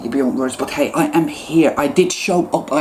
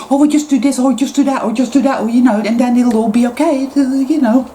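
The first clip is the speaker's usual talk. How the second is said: much higher, louder sing-song mock voice; mimicking comforting clichés in a high, louder sing-song; mocking platitudes; 9 semitones higher, 3 dB louder than usual. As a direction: parody the people handing out easy fixes. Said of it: "we start to come up with plasters and Band-Aid".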